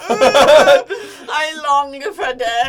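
voicing the dog. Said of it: "And the dog's like".

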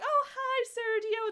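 high-pitched mock cheery hello; mocking, singsong-high imitation of fooled characters; oblivious greeting. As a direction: greet it like a clueless cartoon character. Mocks characters fooled by fake bird.

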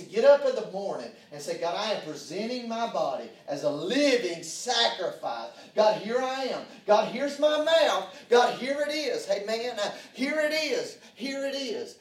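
rising preaching. Acted. Preacher building up prayer.

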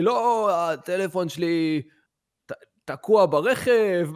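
slow, dragged-out put-on stranger voice; lame excuse. The stranger mumbling his excuse to the narrator, slow and dragged. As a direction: stretch each word like a fumbling liar.

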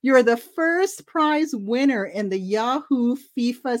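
sing-song prize-winner announcement; chirpy caller. Retold or imitated. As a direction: sell the good news like a sweepstakes caller. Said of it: "And she goes".

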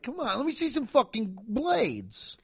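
high, put-on pleading; mock impatience. Fed up after two weeks of snow, mock impatience.

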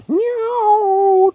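loud, high sung cat meow; long caterwauled note. A narrator's reading. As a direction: yowl a meow as a song. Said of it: "Tom sang".

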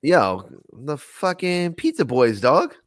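slow hammed-up hype; cool guy hype. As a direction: drag out the cool brag. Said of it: "Like that?".

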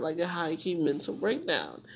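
ordinary talk

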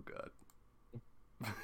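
ordinary delivery

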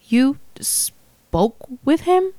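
stunned, halting question; incredulous. A narrator's reading. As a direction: draw it out in disbelief, pitch climbing. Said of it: "She sounded incredulous".

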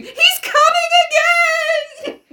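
hammed-up, drawn-out high scream. A narrator's reading. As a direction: shriek the warning, comically over the top. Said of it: "broke out into a scream".

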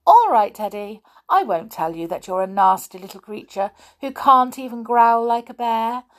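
teasing singsong jibe; annoying taunt. Acted. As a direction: needle him sweetly. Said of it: "said Amelia Jane annoyingly".

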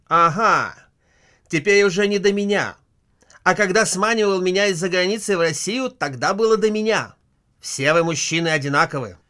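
loud, high indignant outburst; all you men are the same, shouted; indignant wife. Acted. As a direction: throw it back at him, offended. Wife's jealous outburst at husband.

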